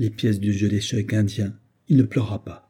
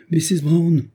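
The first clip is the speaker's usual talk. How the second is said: high, raised-voice shout of a name; loud call; 7 semitones higher, 5 dB louder, slower than usual. A narrator's reading. Man crosses the room towards an inner door, calling loudly. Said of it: "s'écria-t-il d'une voix forte".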